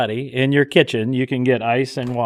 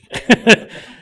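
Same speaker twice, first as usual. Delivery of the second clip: short high burst of laughter; 11 semitones higher, 8 dB louder than usual. Real, not acted. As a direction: laugh at yourself. Laughs at own accent.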